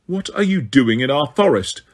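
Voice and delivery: ordinary delivery, nothing marked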